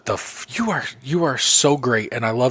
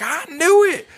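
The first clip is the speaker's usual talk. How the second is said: much higher, loud triumphant cry; excited Thor; 3 dB louder, slower than usual. Retold or imitated. Imitating Thor when Cap lifts the hammer, high and triumphant. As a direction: crow it like you called it all along. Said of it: "'cause he's like".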